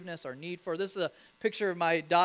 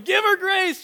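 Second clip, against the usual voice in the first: put-on high, loud child's yell from the other room; yelling kid brother; 11 semitones higher, 10 dB louder than usual. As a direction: call out like an eager little boy. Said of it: "the son's going".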